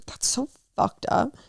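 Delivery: slow, breathy whisper of disbelief; shocked whisper. Real, not acted. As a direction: mutter it under your breath, appalled. Whispered aside about secret therapy.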